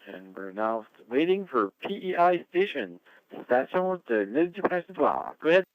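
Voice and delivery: high, loud radio call-out; net control announcer. Real, not acted. Net control calls stations.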